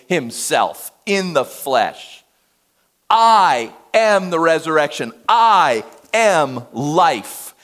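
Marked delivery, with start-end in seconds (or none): 3.1-7.5 louder, very slow, each word weighted; emphatic declaration. Shown as a demonstration. Preacher proclaiming Jesus's words to the congregation. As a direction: pound out "I" and "am" with a heavy fall. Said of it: "it's emphatic".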